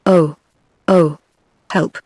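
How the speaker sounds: a touch of fear in the cries, lightly voiced; mild read-aloud cry for help, not fully acted; narrated old man crying out in fright, understated; frightened shout. A narrator's reading. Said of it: "shout in a frightened voice".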